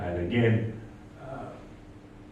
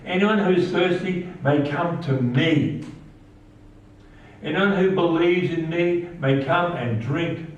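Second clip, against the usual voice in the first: raised preaching voice, calling out; called out; 6 semitones higher than usual. A narrator's reading. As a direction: call it out to the whole festival crowd. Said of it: "shouted to the crowds".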